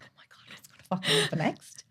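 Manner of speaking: breathless whispered thrill, quick and high; rushed excited whisper. Pretend. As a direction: whisper it like a gripping cliffhanger. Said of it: "I watch the rest of my life like this, going".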